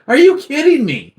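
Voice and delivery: squeaky high outraged question; high mock disbelief. Son mimics dad at the empty fridge, high mock disbelief.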